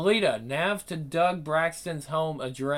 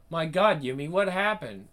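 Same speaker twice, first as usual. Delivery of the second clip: usual speaking voice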